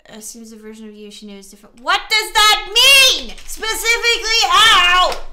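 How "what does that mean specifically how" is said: loud, high, drawn-out demand; exasperated yelling. Real, not acted. Player demanding the game partner explain the accusation, exasperated. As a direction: yell each word in frustration.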